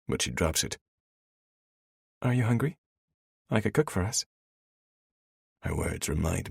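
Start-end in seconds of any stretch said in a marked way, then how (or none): 2.2-4.3 put-on higher, bright young woman; female character voice. A narrator's reading. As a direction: offer it as the heroine. Elena offering.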